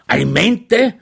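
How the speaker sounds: sharp, high, loud disbelieving repeat; horrified echo. Acted. Chaplain echoes Švejk's word in horror, high and loud. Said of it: "wiederholte der Feldkurat entsetzt".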